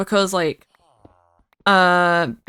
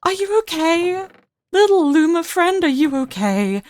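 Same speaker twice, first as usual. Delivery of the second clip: higher, worried mock question; lightly imitated concern, higher pitch; 9 semitones higher than usual. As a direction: ask the little star if it's alright. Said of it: "I'm like".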